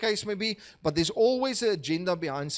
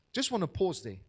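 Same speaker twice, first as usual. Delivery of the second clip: normal talking voice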